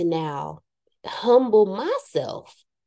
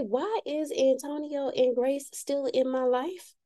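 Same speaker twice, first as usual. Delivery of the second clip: exasperated self-question; 7 semitones higher, 5 dB quieter than usual. Retold or imitated. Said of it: "I ask myself every day".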